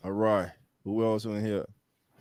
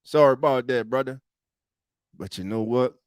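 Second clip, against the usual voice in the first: usual speaking voice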